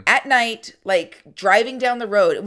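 usual speaking voice

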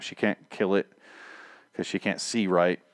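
normal talking voice

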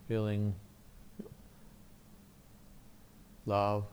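soft, calm guided meditation voice; soft spa whisper. Acted. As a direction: gently guide them through a quiet prayer. Leads guided prayer meditation.

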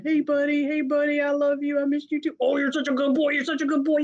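high, loving voice for a beloved dog; doggy baby talk. Retold or imitated. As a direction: coo it up high like greeting a lost pet. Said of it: "he was going".

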